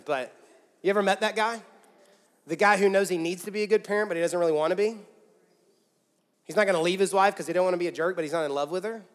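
usual speaking voice

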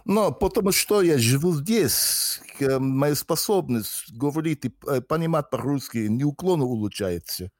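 Russian spoken with a strong native-style accent; fluent, heavily accented Russian. Real, not acted. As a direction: speak Russian with a heavy Russian accent. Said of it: "more of a Russian accent in Russian".

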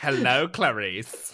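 slow, low, menacing Lecter; Hannibal Lecter. Retold or imitated. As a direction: savour each word like a cannibal. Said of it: "like Hannibal Lecter".

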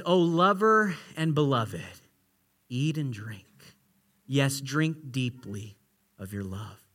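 very soft, low and slow; hushed reverent quote. Retold or imitated. God blessing two young lovers, hushed and slow. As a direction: let it sink almost to a murmur, slowly.

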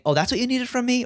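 high, lightly surprised quoted voice; surprised team member. Retold or imitated. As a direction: play a staffer realizing what was needed, mildly surprised. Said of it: "Or somebody saying".

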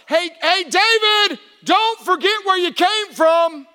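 high, loud, emphatic call; God calling out. Retold or imitated. God warning David to remember, preached shout. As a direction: shout it across to him, drawing it out. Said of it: "David is told by God".

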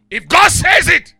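high, slow preacher's shout; shouted proclamation. Real, not acted. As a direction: belt it out slowly to rouse the congregation. Preacher's climax to congregation.

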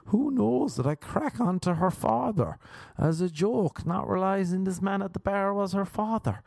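high-pitched run of anxious self-questioning; mock panicked wondering. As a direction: fret aloud, pitched well up. Anxious morning-after self-questioning acted out.

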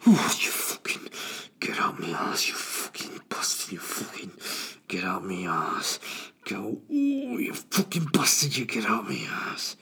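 man straining in the next cubicle, cursing under his breath. Retold or imitated. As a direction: groan and hiss like a man fighting his own bowels. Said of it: "I just hear".

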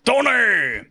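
high, drawn-out mock villain call; Andy Cortez impression. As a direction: call it out as an exaggerated movie impression. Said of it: "three Andy Cortez M C U impressions".

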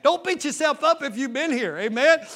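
ordinary delivery

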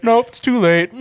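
loud, high comic dismissal; mock doomer. Friend retelling comedian's bit as doomer scientist, loud high refusal. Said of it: "He's like".

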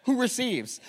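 high, booming Pentecostal shout of praise; hammed-up hallelujah preaching, easing off at the end; Pentecostal preacher. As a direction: erupt into loud, high revival preaching. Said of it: "from pastor to Pentecostal".

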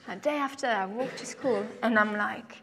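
ordinary delivery

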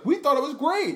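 imitating a delighted, clueless stoner; very high, innocently pleased with the show; high-pitched protest. Stoned bandmate answering Chris, high-pitched protest.

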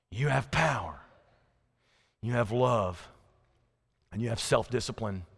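quiet, low, spaced-out closing lines; solemn affirmation. Real, not acted. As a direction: say it to yourself with calm resolve. Preacher's solemn closing self-affirmation.

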